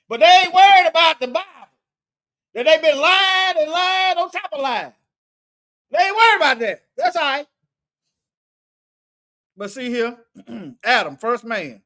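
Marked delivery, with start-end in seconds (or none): 0.1-7.5 higher, louder and fast, rising indignation; raised voice scolding the doubters; fired-up preaching. Real, not acted. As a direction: hit it like a preacher calling out hypocrites. Preacher rising against critics.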